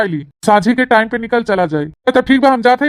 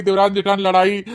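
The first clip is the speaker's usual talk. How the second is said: ordinary talk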